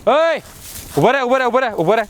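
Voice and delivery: urgent stop-right-there call. Acted. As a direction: call sharply after someone to stop. Calls out to stop a trespasser.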